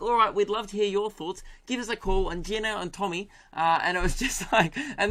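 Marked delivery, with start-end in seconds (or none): 0.0-2.3 high mock Kiwi radio host; New Zealand accent. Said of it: "I've got it now".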